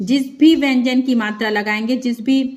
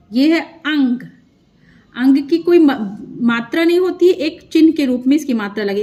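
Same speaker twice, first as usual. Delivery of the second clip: ordinary talk, nothing marked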